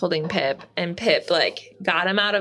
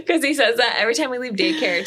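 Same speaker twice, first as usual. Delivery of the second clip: normal talking voice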